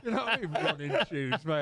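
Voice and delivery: laughing through the words; chuckling echo of the phrase. Retold or imitated. Retelling, laughing at himself.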